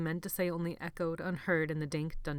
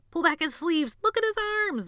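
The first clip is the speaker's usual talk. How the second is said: much higher, louder gleeful crow; 12 semitones higher, 9 dB louder than usual. A narrator's reading. Benny crowing to the crowd. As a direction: crow in triumph. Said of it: "Benny crowed".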